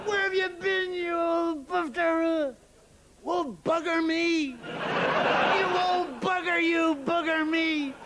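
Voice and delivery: high, drawn-out matey greeting; hearty friendly insult. Acted. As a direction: hail an old pal with affectionate abuse. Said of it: "when we were actually being friendly with people".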